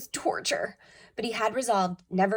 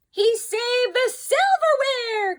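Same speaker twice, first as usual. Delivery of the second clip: very high, louder, slow cry; overjoyed Mother; 6 dB louder, slower than usual. A narrator's reading. Mother thrilled the silverware was saved. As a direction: gush with delight. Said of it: "cried Mother".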